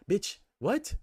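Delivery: high squeaky disbelief on what; incredulous squeal. Real, not acted. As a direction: shriek the what as if you can't believe it. Incredulous at Trump-voting marcher.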